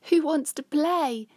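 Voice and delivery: high, loud happy call; playful shout. Acted. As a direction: call out to friends to come play. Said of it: "he shouts".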